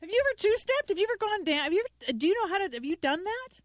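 excited questions. Real, not acted. Eager questioning about two-stepping.

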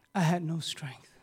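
breathless whisper. Retold or imitated. Preacher telling the congregation how he ran out of strength, in a whisper. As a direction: whisper it as if spent. Exhausted swimming back.

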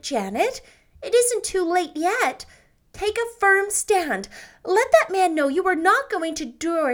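lightly voiced eager young woman; rallying. A narrator's reading. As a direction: stiffen her spine. Anne rallies Janet.

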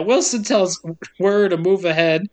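ordinary talk

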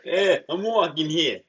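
loud, slow, hammed-up New York accent; over-the-top Brooklyn street yell. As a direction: bellow it like a bad New York cabbie. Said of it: "try to do his best New York accent".

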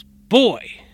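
a huge, high barked shout; Marine bellow. Retold or imitated. Ex-Marine yelling at the possessed boy, full bellow. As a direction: bark it like a drill sergeant at a frozen kid. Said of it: "in his best Marine voice".

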